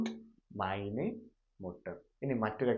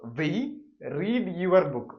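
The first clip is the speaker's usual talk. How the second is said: higher, louder example read; emphatic example; 7 semitones higher, 7 dB louder, much slower than usual. Shown as a demonstration. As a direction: announce the example sentence. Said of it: "അടുത്തൊരു example".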